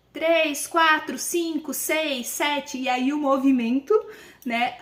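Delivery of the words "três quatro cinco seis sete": put-on hurried, higher count like an anxious child; rushed counting. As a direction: race through the numbers like a child. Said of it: "eles começam assim". (contagem corrida de criança ansiosa)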